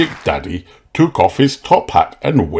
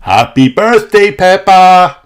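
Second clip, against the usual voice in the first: high, loud, sing-song wish; cheerful birthday greeting; 10 semitones higher, 7 dB louder than usual. A narrator's reading. Mummy and Daddy greeting Peppa on her birthday, bright. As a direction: greet her like a proud parent. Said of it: "Mummy and Daddy Pig said together".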